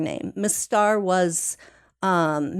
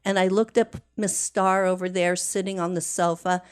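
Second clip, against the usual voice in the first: normal talking voice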